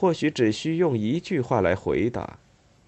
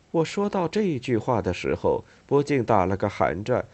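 ordinary delivery, nothing marked

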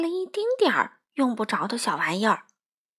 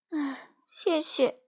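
listless; 5 semitones higher, 4 dB quieter, slower than usual. Acted. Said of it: "一副兴致不高的样子".

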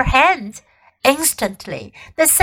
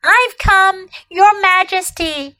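put-on higher, eager boy voice; 6 semitones higher than usual. A narrator's reading. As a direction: rush it out eagerly. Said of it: "rushing eagerly forward".

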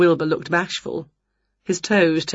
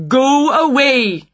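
loud, slow, higher-pitched dismissal; cross conductor; 7 semitones higher, 5 dB louder, slower than usual. A narrator's reading. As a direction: shoo them off firmly and loudly. Conductor shooing Winnie off.

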